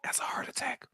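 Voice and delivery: hushed whispered aside; secretive whisper. Pretend. Co-host keeping up the secret exchange, whispering. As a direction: keep it under your breath, whispering.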